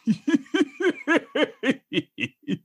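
high villainous cackle; long, high-pitched evil laugh; evil emperor cackle. Acted. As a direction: cackle like a gloating dark lord. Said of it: "Emperor Palpatine".

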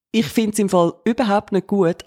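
ordinary talk, nothing marked

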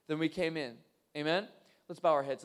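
ordinary delivery

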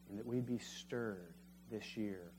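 ordinary talk, nothing marked

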